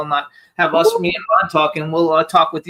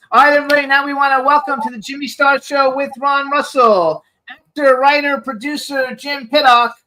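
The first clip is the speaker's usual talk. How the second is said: raised, upbeat show-host announcement; announcer intro; 7 semitones higher, 3 dB louder than usual. Acted. Host welcoming viewers, booming announcer voice. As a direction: sell the guest's name like a TV host.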